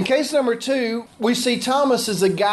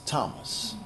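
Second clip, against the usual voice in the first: breathy whisper, much lower and softer; whispered name; 9 semitones lower, 12 dB quieter than usual. Real, not acted. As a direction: let the name fall away in a whisper. Naming Thomas reverently at close.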